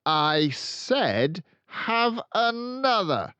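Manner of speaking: high, loud, slow threatening order; sharper edge. A narrator's reading. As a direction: let real steel in, each word cutting. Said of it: "an altogether sharper edge to her voice".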